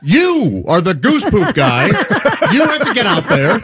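slow, emphatic telling-off, pitch swooping high; mock-stern scolding. Host reenacts ordering the goose poop guy out, scolding. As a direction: lay down the law, drawn out.

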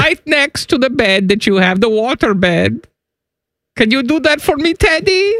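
put-on high, climbing German accent; creepy German flirt, rising. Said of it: "in your German voice".

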